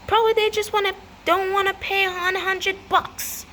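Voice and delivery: high-pitched indignant whine; scornful grumble. Acted. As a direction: complain about the cheapskates. Grumbling mockery of cheating neighbours.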